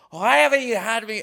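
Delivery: a hint of Irish lilt, coaxing; Irish accent. Quoting a high Irish guy at a party asking about dinner, mild Irish accent. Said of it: "he's like, Irish".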